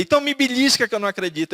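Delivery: amazed disbelief. Retold or imitated. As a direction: mimic the stunned wife begging to be pinched. Wife amazed at his conversion.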